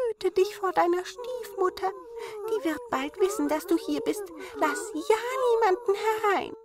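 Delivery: high, worried dwarf warning; warning dwarves. A narrator's reading. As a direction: plead with her to be careful. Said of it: "warnten es die guten Zwerglein".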